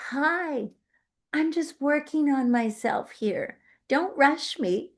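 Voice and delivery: shy little sprout. Retold or imitated. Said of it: "a shy little shoot".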